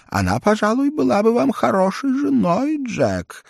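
put-on high, sly old lady; hint at a match, sweetly. A narrator's reading. Старая дама сватает Джен. (голос старой дамы)

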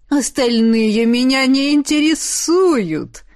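slow, smiling and seductive. A narrator's reading. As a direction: purr it with a charming smile. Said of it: "обольстительно улыбнулся Луиджи".

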